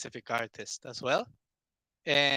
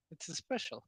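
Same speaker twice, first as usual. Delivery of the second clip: quiet; 3 semitones higher, 10 dB quieter than usual. Real, not acted. Repeating softly.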